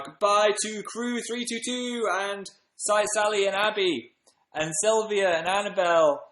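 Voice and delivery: high, drawn-out goodbye roll-call; high sign-off to the fans; sing-song farewell. Real, not acted. Signing off, calling out viewers' names.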